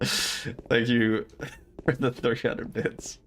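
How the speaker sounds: slow thanks broken by laughter; laughing thanks. Real, not acted. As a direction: thank them while cracking up.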